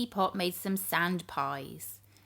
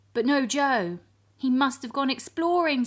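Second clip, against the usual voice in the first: high, loud worried voice; alarmed Grandpa; 5 semitones higher, 6 dB louder than usual. Acted. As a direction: fret out loud. Grandpa realises Joe missing.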